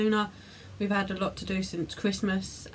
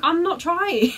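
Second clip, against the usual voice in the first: laughing; 7 semitones higher, 8 dB louder, slower than usual. Real, not acted. Laughing while denying it.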